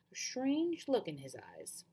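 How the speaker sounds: plain reading voice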